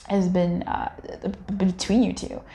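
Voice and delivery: normal talking voice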